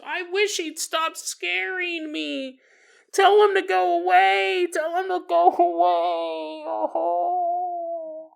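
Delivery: high, slow, drawn-out wail; hammed-up begging that dissolves into a moan; mock terrified pleading. Acting the frightened dying mother pleading.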